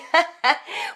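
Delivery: bright high burst of laughter. Real, not acted. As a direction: laugh at her own morning voice. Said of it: "with my morning voice".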